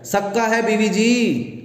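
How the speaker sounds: high, slow servant's call from a distance; calling from afar. Acted. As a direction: shout back from the far end of the house. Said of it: "للو دور سے بولتا ہے".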